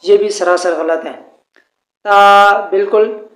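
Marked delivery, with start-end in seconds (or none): 2.0-2.5 تا as a light open "taa", not rounded; thin pronunciation. Shown as a demonstration. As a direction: keep the long vowel of تا thin. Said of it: "باریک پڑھنا ہے اس کو".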